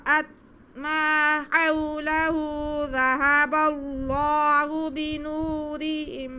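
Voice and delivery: slow, high, loud melodic tajweed chant; measured tajweed recitation, each word drawn out; Quran recitation. Shown as a demonstration. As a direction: recite each phrase stretched and ringing like a qari. Opening recitation of the verse.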